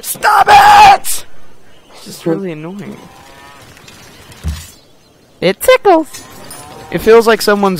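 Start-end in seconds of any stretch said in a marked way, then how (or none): 0.0-1.2 shrill yelled stop. Pretend. Acted smack at foot. 5.4-6.2 squeaky high voice. Pretend. Squeaky tickle voice.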